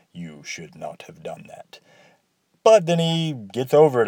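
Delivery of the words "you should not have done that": hushed, slow, menacing whisper; serious whisper. Retold or imitated. Transylvanian barber warns the player who killed Kane, whispered. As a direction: breathe it out as a grave warning. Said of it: "He becomes very serious".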